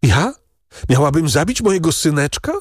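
high, quick unhinged outcry; a mother gone mad, half-shrieking; madwoman's cry. Acted. Deranged mother crying out at her husband in madness. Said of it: "zawołała ta straszliwa matka".

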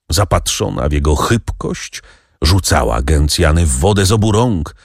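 plain reading voice

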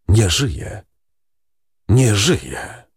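understated echo, each repeat set apart by a pause; echoing second voice. A narrator's reading. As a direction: say it like a distant echo. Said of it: "niczym echo, drugi głos mówiący". (niczym echo, z pauzą przed powtórzeniem)

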